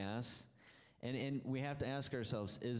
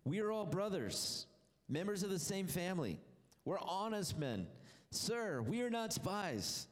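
higher, earnest protest, slower at the end; exclaimed plea; 8 semitones higher than usual. A narrator's reading. Brothers pleading with Joseph: higher, earnest exclaim. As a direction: plead your innocence up high. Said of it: "they exclaimed".

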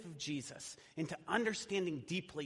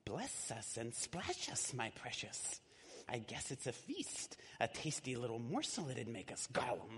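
Gollum whisper; 5 semitones lower, 6 dB quieter than usual. Acted. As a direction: hiss it out like a hungry little creature. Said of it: "whispered and hissed".

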